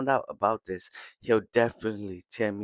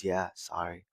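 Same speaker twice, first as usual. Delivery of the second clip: quiet apology; 3 semitones lower, 8 dB quieter than usual. Retold or imitated. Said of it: "She's still quiet".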